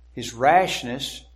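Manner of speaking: usual speaking voice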